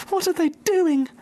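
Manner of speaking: very high frightened little voices; small foxes crying. Acted. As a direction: cry out like scared fox cubs. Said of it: "cried the small foxes".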